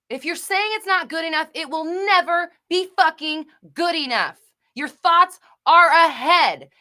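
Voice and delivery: loud, high, shouted tough-love rant; screaming rant. Real, not acted. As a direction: hammer every line at full volume. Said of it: "That's why I was screaming".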